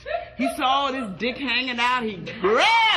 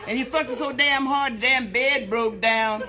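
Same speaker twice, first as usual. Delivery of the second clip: usual speaking voice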